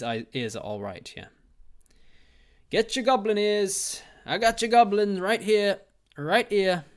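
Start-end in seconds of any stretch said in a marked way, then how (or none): 2.7-6.8 loud, high market-vendor cry; mock street hawker. Mock hawker selling goblin ears.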